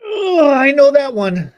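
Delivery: oh-no-been-there groan; pained recognition. Real, not acted. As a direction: groan it out slowly in dismay. Groaning reaction to the story.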